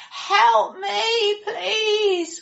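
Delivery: high, loud desperate cry for help. Acted. Wounded Abe begging the traveller for help, loud desperate cry. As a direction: call out as if hurt and pleading. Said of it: "cried out Abe".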